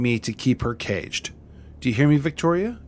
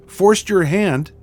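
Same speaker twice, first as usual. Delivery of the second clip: outraged; 7 semitones higher, 6 dB louder, slower than usual. Acted. Outraged echo of excuse.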